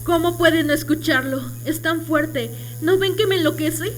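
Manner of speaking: high-pitched, desperate pleading; cracking under an unbearable noise, pitched high; frantic distress. Acted. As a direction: beg them to hear what torments you. Maddened by sound others cannot hear.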